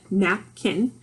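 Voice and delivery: "napkin" as nap, pause, kin; split into syllables. Shown as a demonstration. Teacher showing napkin has two syllables, "napkin" split in two. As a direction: separate both beats of "napkin". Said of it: "has two syllables".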